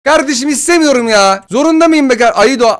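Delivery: high-pitched, fed-up complaint; exasperated protest. Real, not acted. Gamer refusing a player in his team, exasperated protest.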